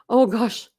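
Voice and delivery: ordinary delivery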